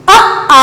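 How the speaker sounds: अ clipped short, a gap, then आ held long; quick अ, drawn-out आ; short then long vowel. Shown as a demonstration. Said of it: "मैं कैसे बोल रही हूँ". (छोटा अ, लंबा आ)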